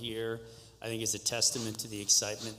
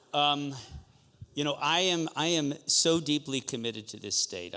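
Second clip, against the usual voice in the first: louder, raised-pitch campaign push; rally speech; 4 semitones higher, 5 dB louder than usual. Real, not acted. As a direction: fire up the crowd. Candidate's passionate stump speech.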